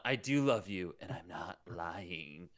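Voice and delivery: low, soft love confession, slowing down; earnest declaration. Acted. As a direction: confess it low, dying away slowly at the end. Performs script line slow, soft.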